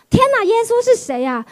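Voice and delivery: high, astonished cry; amazed exclamation. Retold or imitated. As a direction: gasp it out in wonder. Amazed release from prison.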